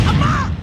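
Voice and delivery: loud, very high "amma" cry; yelp of pain. Acted. Victim hit by the bully, loud high yelp. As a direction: shriek as the blow lands.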